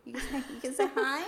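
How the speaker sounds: high sing-song coaxing. Real, not acted. Coaxing child to say hi.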